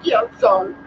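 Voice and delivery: put-on high little-kid voice; children apologizing. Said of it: "They're like".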